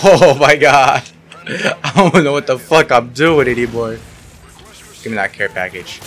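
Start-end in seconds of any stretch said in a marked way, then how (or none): 0.0-4.0 laughing. Real, not acted. Laughs at own play.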